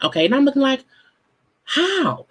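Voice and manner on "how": high, outraged how; incredulous. Retold or imitated. Host confronting the contestant's logic, high and outraged. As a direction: demand it in disbelief.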